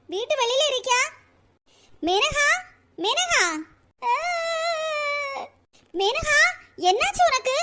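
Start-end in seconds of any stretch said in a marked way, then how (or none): 2.1-7.5 high calls of her name; calling out. Acted. Older sister calls Menaka from outside the house, calling out. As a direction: call out to someone out of sight.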